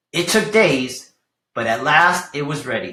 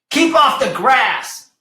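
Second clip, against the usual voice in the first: higher, slow stretched shout; 9 semitones higher, 4 dB louder, slower than usual. Acted. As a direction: bark the order. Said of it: "shouted Hank".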